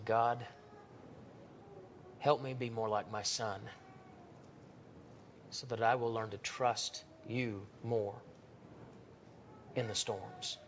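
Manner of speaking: father praying beside his sleeping son; humble father's prayer. Retold or imitated. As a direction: pray quietly, moved, beside a sleeping child.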